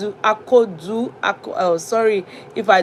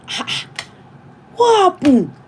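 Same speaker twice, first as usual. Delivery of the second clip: a husband shaken to find his wife in tears; worried husband; 10 semitones higher than usual. Retold or imitated. Husband finding his crying wife, high alarmed question. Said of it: "he was just looking".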